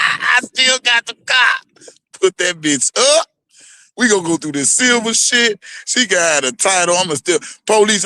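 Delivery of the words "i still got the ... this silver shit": gloating brag. Real, not acted. Gloating after laugh.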